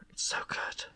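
hushed, whispered gushing; overcome whisper. Real, not acted. Overcome gushing about the romance.

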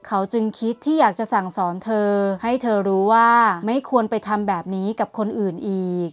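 ordinary delivery, nothing marked

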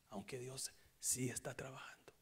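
hushed, breathy confession; whispered aside about God. Real, not acted. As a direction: confide it in a whisper.

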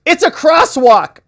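high, loud, slow cry of it's a crosswalk; shouted objection. Retold or imitated. Defense lawyer shouting at the witness, loud and high. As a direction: shout it across the courtroom, indignant. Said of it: "She's shouting it out".

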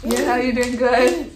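high, bright mommy voice. Real, not acted. Mother coaxing toddler.

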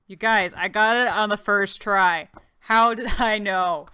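loud triumphant cheer; excited. Real, not acted. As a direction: crow about the lucky guess. Celebrating first try solve.

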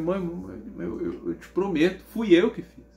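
retold plea of a child close to tears; near-tearful pleading. Scared son promising mother he drew it, pleading. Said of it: "eu já tava quase chorando".

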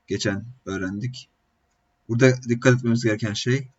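ordinary talk

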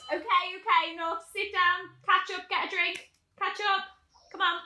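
high, loud and insistent; bossy hurrying. Acted. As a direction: cut them off and hustle them along. Cutting short the admiration, urging drinks.

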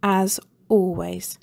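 ordinary delivery, nothing marked

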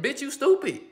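playful hammed-up scold; blunt mock scolding. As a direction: let her have it without sugarcoating. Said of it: "I wouldn't have sugarcoated it".

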